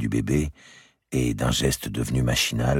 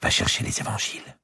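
whispered; 9 semitones higher than usual. Acted. Madame de Bouville whispering to her husband, an urgent whisper. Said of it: "elle chuchota".

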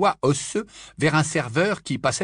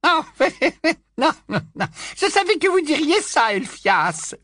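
put-on high, loud cackling old aunt; old aunt chuckling at Dodge; 4 dB louder than usual. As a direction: giggle through it, teasing. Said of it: "gloussa la tante Muriel".

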